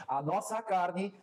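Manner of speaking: measured preaching building in pitch; emphatic preaching. Real, not acted. Preacher warning the church about the flesh, emphatic preaching. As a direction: drive the point home, stretching key words.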